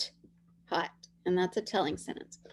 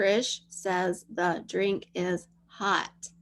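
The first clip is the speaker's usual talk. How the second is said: slow dictation; slower than usual. Shown as a demonstration.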